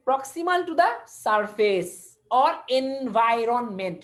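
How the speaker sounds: definition drawn out word by word; slow careful dictation. Shown as a demonstration. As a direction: say the definition very slowly for notes. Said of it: "teacher dictates definition".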